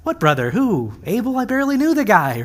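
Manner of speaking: hammed-up feigned ignorance; dodging the question. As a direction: play dumb, pitch climbing as you deny it. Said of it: "Cain tries to dodge the question".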